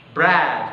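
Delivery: "Breath" loudly, with a clear th at the end. Shown as a demonstration. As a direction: stress the th in "Breath". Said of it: "menjadi breath". ("Breath" dengan bunyi th jelas)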